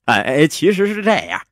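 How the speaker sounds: high, hesitant backpedalling; comic evasive hero; flustered excuse-maker. Acted. Hero hastily makes excuses.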